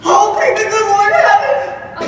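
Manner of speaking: loud, slow, laughing thanks to heaven; relieved joyful cry. Acted. Relieved outburst at news.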